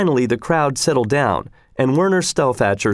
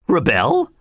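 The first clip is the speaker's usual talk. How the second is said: higher, rising in disbelief; startled cry; 4 semitones higher than usual. A narrator's reading. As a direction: echo it back, shocked. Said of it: "cried everybody".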